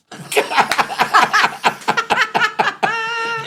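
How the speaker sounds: long, high, loud cackling laugh; real helpless laughter. Real, not acted. Listener hearing the Scottish drunk story, helpless laughing. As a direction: burst out laughing at the punchline.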